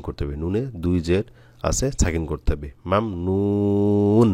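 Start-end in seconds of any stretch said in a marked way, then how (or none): 2.9-4.3 very slow মাম নুন, the final ন held closed; মাম নুন ending on a silent, held ন; stop on sakin. Shown as a demonstration. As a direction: recite মাম নুন stretching the vowels, no vowel after the last ন. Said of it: "এভাবে".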